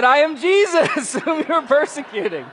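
loud high reveal through laughter; laughing reveal. Retold or imitated. Jesus revealing himself to Saul, laughing. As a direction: announce it, laughing at the irony.

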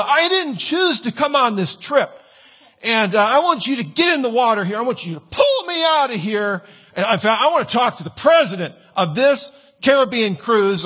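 put-on high, whiny voice of a spoiled teen; entitled teenager. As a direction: demand it like a spoiled brat. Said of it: "just going".

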